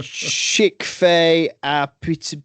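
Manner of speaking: slow, hammed-up French pronunciation; drawled mock French words; mock French accent. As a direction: say it like a confident fake Frenchman. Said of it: "You convincingly sounded French".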